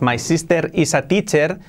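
"My sister is a teacher" with tight "ee" in sister and is; Spanish-accented English. Shown as a demonstration. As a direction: say "sister" as SEES-ter with tapped r's. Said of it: "pues no, my".

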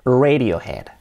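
"Radiohead" as REY-dio-hed; English-style RAY-dio-head; English pronunciation. Shown as a demonstration. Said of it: "el nombre sería".

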